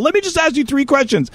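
high, pleading mock voice; imitating canvasser. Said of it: "He's like".

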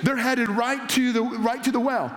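ordinary delivery

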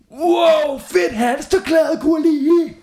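put-on high loud kids cheering; excited shout. A narrator's reading. Classmates cheering Gurli's scarf. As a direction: shout it in delight. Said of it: "råbte flere af børnene".

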